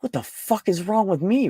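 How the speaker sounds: usual speaking voice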